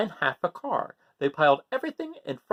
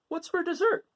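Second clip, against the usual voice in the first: Gregory's voice; 14 semitones higher than usual. Acted. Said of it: "said Gregory".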